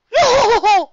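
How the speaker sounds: loud booming skeleton laugh; big jolly laugh; hearty laugh. Acted. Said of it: "see Brooke finish laughing".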